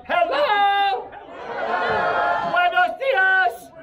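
loud, slow showman hello; loud greeting. Acted. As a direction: call out to the crowd. Greeting the crowd.

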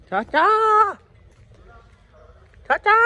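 loud high call across. Real, not acted. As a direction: shout for him from afar. Calling out to uncle. (ਉੱਚੀ ਹਾਕ ਮਾਰਨਾ)

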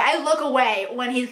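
usual speaking voice

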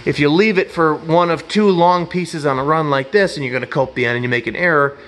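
normal talking voice

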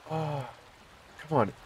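ordinary talk, nothing marked

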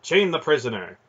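officer's sharp command; sharp order. A narrator's reading. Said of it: "he ordered sharply".

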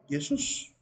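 "Jesús" with a Y sound, Yesús; Greek pronunciation. Shown as a demonstration. Teacher showing how the apostles said the name, Greek pronunciation. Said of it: "digamos como decían los apóstoles".

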